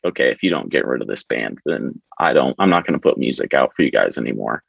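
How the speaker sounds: a hint of a pompous musician making threats; mock ultimatum. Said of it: "he kinda basically told the label, like".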